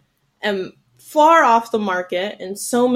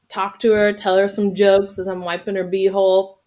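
normal talking voice

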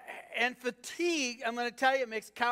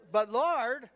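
mock objection, pleading and pushed; a louder, pleading protest to God; 3 semitones higher, 6 dB louder than usual. Shown as a demonstration. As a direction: plead it with more force. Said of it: "come on, say it like".

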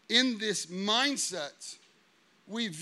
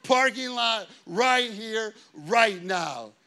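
louder retold comeback, squaring up; mock loud challenge; 6 dB louder than usual. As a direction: throw the dare right back. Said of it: "I'm like".

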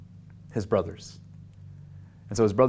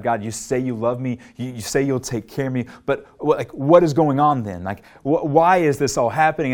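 louder, rising mock protest to God; retold yelling at God; 4 semitones higher, 6 dB louder than usual. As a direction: replay the angry cry to heaven, half-voiced. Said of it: "stopped yelling at God".

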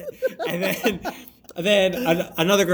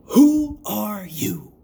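very slow, portentous question; dramatic intoning; 4 semitones higher, 4 dB louder, much slower than usual. Acted. Host intoning the episode's big question to listeners, dramatic. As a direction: draw out each word like a grand mystery.

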